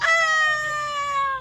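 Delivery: sharp high yelp of pain; a short shrill yelp as she falls; cry of pain. Acted. Child falling while playing, high pained yelp.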